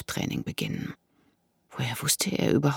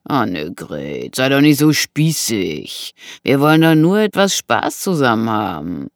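cajoling intruder; 4 dB louder than usual. Acted. Intruder coaxes frightened Annegret to play along, cajoling. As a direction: wheedle her with lazy menace.

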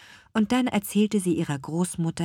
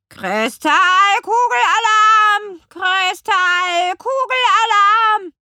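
raven screeching its alarm, much higher and louder, slow; croaking raven; 11 dB louder, slower than usual. A narrator's reading. Raven warns the witch about the crystal ball, screeching. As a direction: squawk it like a frantic raven. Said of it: "krächzte der Rabe".

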